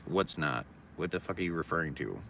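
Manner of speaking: normal talking voice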